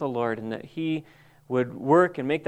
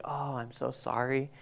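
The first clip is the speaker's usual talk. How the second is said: soft sympathy; 12 dB quieter than usual. Retold or imitated. Friend offers sympathy for supposed grief.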